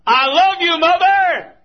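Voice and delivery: a loud, high holler stretched out. Retold or imitated. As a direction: holler it so she hears through the phone. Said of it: "And I hollered".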